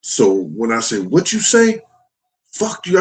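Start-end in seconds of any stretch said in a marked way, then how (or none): 1.0-1.8 high-pitched, incredulous retold demand; challenging retort. Father challenging his daughter on the steps, high-pitched retort. 2.5-3.0 retold defiance. Retold daughter's defiant answer.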